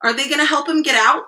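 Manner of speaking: plain reading voice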